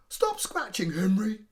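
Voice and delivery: both parents chide their son; joint scolding. Acted. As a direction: parents scold Henry loudly.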